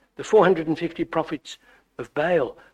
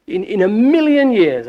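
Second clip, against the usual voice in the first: normal talking voice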